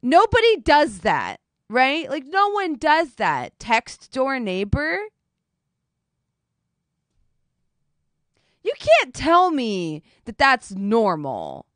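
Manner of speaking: insisting to chat, slow and loud; emphatic rant. Real, not acted. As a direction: drive each word home. Ranting at chat slowly.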